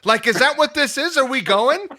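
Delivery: high, loud mock fighting challenge; heated challenge. Patient challenging the yelling doctor to fight, loud and high. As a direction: square up and shout it at him. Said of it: "it got really heated".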